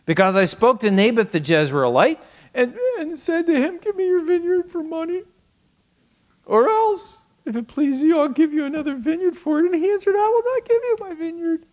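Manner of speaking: high, put-on voice of a sulking king; whiny Ahab. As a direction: read Ahab's words as a petulant whine. Ahab voiced in scripture reading.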